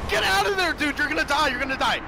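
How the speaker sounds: high urgent shouting; excited yelling. Real, not acted. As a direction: yell a frantic warning. Friend yelling warnings.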